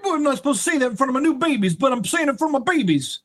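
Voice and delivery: high, fast giddy rant; playful over-the-top outburst; excited rant. Real, not acted. Host ranting to his new babies, high and giddy.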